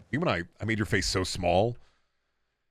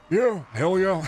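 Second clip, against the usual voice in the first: lightly voiced gruff wrestler; a hint of Stone Cold growl; Stone Cold impression; 7 semitones higher, slower than usual. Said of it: "You can do Stone Cold".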